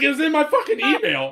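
high, loud, laughing through it; laughing protest. Retold or imitated. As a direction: laugh out the excuse. Defending himself, laughing.

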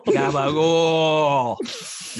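slow, drawn-out mock wise guy shout; Tony D'Angelo. Echoing the co-host's catchphrase, long hammed-up wise guy call. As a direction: stretch it out like a hammy mobster. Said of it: "He just said".

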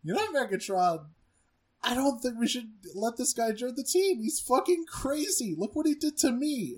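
high, rising whiny complaint; whiny Thrust. Retold or imitated. Bandaged Thrust complains to Megatron, high whiny voice. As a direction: whine to the boss. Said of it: "Thrust who says".

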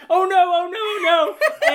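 loud, high hammed-up panic; panicked wail, half laughing; mock panic. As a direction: act out frantic dread for laughs. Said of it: "working ourselves into such a panic".

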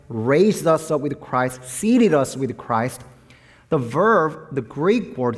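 ordinary delivery

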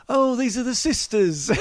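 high, laughing English gent voice; posh English gentleman. Retold or imitated. Quoting Justin introducing the sisters, as an English gentleman. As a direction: imitate a chuffed Englishman through a laugh. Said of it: "Like this".

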